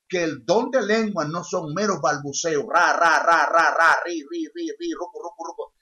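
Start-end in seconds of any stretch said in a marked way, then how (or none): 2.7-5.7 mock babbling. Shown as a demonstration. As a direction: parody fake tongues babbling. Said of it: "Eso no es bíblico". (balbuceo burlón imitando lenguas falsas)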